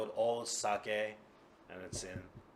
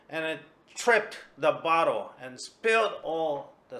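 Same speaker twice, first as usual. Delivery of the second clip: worked-up excuse; 6 semitones higher, 10 dB louder than usual. Acted. Kuma's drunken excuse story.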